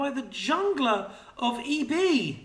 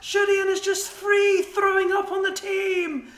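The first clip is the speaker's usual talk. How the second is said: higher, loud, roaring play-by-play; excited shouting; 8 semitones higher, 4 dB louder than usual. Real, not acted. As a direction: scream the climax of the fight. Climactic team fight calling.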